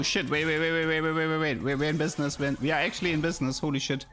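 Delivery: ordinary delivery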